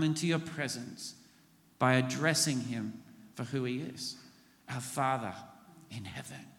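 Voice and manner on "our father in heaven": hushed, much softer; a quiet reverent address; softly. Shown as a demonstration. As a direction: breathe it out reverently. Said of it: "Say that with me".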